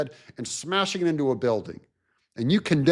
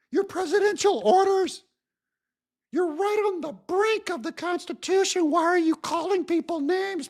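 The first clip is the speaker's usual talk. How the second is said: whiny mock Republican; 12 semitones higher than usual. Republicans scolding President Trump, whiny high mock shout. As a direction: squeal it like a scandalized scold. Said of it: "the Republicans are going in and going".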